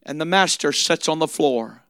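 soft, hushed, gentle sermon close; quiet tender preaching. Acted. Preacher softly telling the congregation to just wash their feet.

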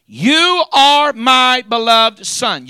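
slow, loud, high proclamation; voice from heaven. Acted. Preacher recalling heaven's declaration, slow and loud. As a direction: draw it out like a royal decree. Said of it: "Here's a voice that says".

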